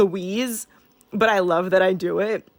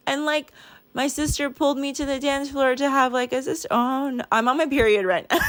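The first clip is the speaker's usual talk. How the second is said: higher, tearful voice; choked up; 6 semitones higher than usual. Real, not acted. Telling viewers about the sister dance, choked up. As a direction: fight back tears, voice climbing.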